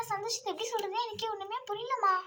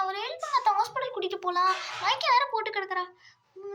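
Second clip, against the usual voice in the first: normal talking voice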